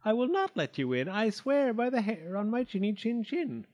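high, frantic piglet vow; high-pitched scared defiance; shrieking pig, restrained. A narrator's reading. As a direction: squeal out the oath without shouting. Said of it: "shrieked the pig".

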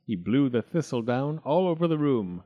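usual speaking voice

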